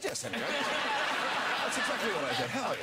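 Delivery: laughter bubbling over each word; words breaking into laughs, real amusement; laughing through the words. Real, not acted. Laughing while quoting himself to the host.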